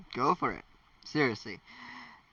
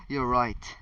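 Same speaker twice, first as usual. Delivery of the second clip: plain reading voice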